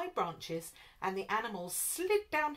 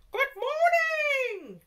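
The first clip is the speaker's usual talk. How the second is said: very high, loud, slow trumpet call; trumpeting elephant; 9 dB louder, much slower than usual. A narrator's reading. Elephants trumpeting a greeting to giraffe. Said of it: "The elephants would trumpet".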